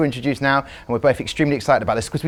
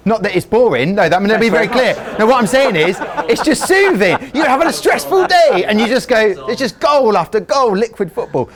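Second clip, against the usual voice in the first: excited; 8 semitones higher, 4 dB louder than usual. Real, not acted. Excited rant about soothing goals.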